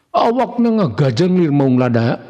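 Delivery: ordinary delivery, nothing marked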